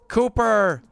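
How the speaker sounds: high, loud hard-of-hearing call of a name; David Lynch's deaf character. Retold or imitated. Impression of the deaf Twin Peaks character calling an agent, high and loud. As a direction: shout the name like a man who can't hear himself. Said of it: "and he talks like this".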